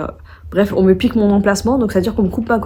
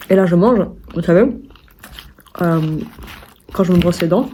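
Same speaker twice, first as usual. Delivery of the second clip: ordinary talk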